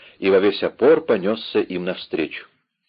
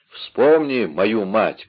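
ordinary delivery

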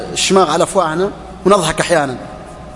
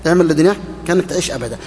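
quick, offhand repeat; much faster than usual. Shown as a demonstration. Preacher mocking how glibly people recite it, fast and light. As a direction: toss it off fast like a line everyone knows by heart. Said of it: "يحفظونها كما نحفظها".

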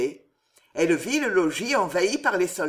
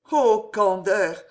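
tearful murmur; 5 dB louder, slower than usual. A narrator's reading. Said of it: "murmura Jeanne avec un sourire tout mouillé de pleurs".

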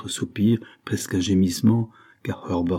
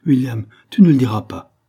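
louder, higher imploring appeal; pleading; 4 semitones higher, 8 dB louder than usual. A narrator's reading. Anna begs William not to tell, pleading. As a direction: beg with mounting urgency. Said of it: "en prenant un ton suppliant".

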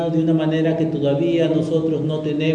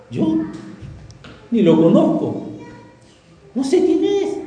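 panicked denial, jumping up high at the end; Peter's denial; 7 semitones higher than usual. Retold or imitated. Peter caught by a servant girl, flustered denial rising high. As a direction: brush it off in a flustered rush. Pedro denying knowing Jesus.

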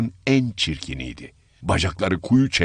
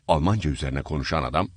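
ordinary delivery

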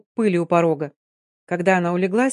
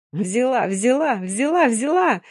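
gleeful yell, pitch up; yelling loudest; 5 semitones higher, slower than usual. Acted. Girl hollering in the dust after the theft, gleeful yell. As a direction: cry it out, jumping for joy. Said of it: "громче всех вопила".

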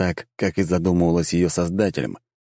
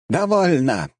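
town crier; 9 semitones higher, slower than usual. A narrator's reading. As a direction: boom it out like a herald. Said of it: "прозвучал голос глашатая".

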